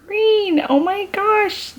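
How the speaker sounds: thrilled squeal at a green shade; excited squeal. Real, not acted. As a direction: shriek with joy at the colour.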